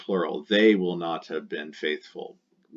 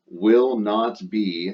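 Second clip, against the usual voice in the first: slow modelling; 5 dB louder, much slower than usual. Shown as a demonstration. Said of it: "slow quoted phrase".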